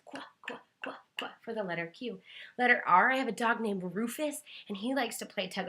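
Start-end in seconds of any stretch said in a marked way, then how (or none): 0.0-1.4 breathy unvoiced quack quack; whispered quack. Shown as a demonstration. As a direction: whisper quacks like a tiny duck. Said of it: "just like their little duck beaks".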